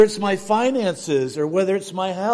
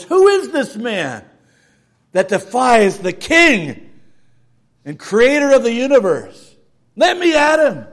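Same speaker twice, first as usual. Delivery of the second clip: loud, bold challenge rising high at the end; defiant David; 5 semitones higher, 8 dB louder than usual. Retold or imitated. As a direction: throw down the challenge. David defiant in retold story.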